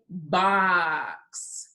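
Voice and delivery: BOX drawn out with a long vowel; said slowly. Shown as a demonstration. Teacher asking pupils for the sounds, BOX said slowly. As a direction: stretch BOX through to its final sounds. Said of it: "I will say a word slowly".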